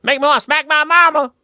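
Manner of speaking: South Carolina accent, exaggerated; Southern accent. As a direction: imitate a South Carolina buddy, hammed up. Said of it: "He always talks so funny".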